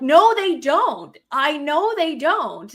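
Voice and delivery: digging in hard on the recycling point; retold angry outburst. Wife reliving fight with husband, angrily insisting she's right. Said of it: "like, super pissed".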